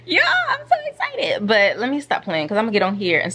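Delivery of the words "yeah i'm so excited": much higher, squeaky and louder; real giddy squeal; squealing excitement. Real, not acted. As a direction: squeal it like you can't contain yourself.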